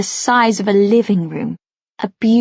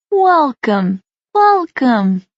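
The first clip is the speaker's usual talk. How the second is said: warm welcoming cry; 4 semitones higher, 4 dB louder, much slower than usual. A narrator's reading. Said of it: "she cries".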